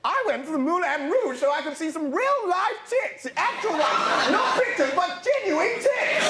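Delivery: high, overexcited silly prince; Edward. Acted. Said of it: "I'm Edward".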